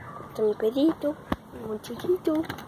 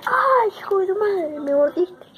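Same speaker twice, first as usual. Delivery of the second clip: yelling; 4 semitones higher, 6 dB louder than usual. Retold or imitated. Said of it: "Me dice".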